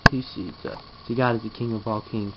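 ordinary talk, nothing marked

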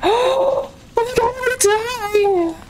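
gasp, then a high, slow, crying wail of fear; frightened high cry after a gasp; real fright. Real, not acted. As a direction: yelp in genuine panic and drag it out. Sudden jump scare in game.

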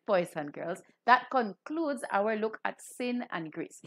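normal talking voice

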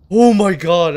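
sudden loud startled cry. Real, not acted. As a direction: jump at the scare. Jump scare in horror game.

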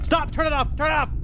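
high, urgent panicked yelps; panicked cry. Real, not acted. Frightened player wanting it turned off, panicked cry. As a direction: beg in a panic, pitch jumping up.

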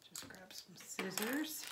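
breathy murmur while reaching for scissors; whispered aside. Real, not acted. Vlogger muttering to herself while opening the parcel, whispered. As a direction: whisper it, a quick aside to yourself.

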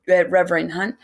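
ordinary delivery

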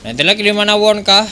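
esports play-by-play. Acted. Live esports play-by-play casting.

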